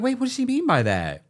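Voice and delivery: high, puzzled double take; confused surprise. Retold or imitated. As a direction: sound thrown by what she just heard. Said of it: "I was like".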